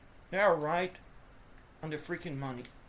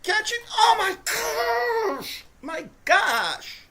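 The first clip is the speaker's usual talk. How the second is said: high, loud, drawn-out groan; exasperated outcry; frustrated shout; 10 semitones higher, 6 dB louder, much slower than usual. Real, not acted. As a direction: yell at the receiver to catch it. Another dropped pass.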